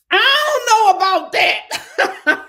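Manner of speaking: high, drawn-out holler; loud exclamation. Real, not acted. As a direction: belt it out slowly, high and loud, near laughter. Exclaiming at a surprising comment.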